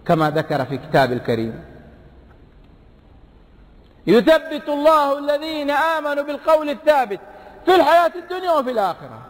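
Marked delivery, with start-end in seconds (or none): 4.1-9.2 high, ringing recited verse; Quran recitation. Acted. Preacher reciting the verse to the congregation, high and intoned.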